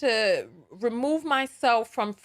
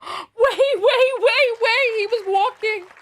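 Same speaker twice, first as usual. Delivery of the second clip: high, loud, laughing disbelief; laughing shriek; 8 semitones higher, 5 dB louder, slower than usual. Real, not acted. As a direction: squeal it through laughter. Host reacts, laughing shrieks.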